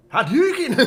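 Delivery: high, very loud Scottish battle cry; hammed-up Scottish-accented yell; Scottish accent shout. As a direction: bellow a game attack in mock Scottish. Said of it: "Hadouken with a Scottish accent".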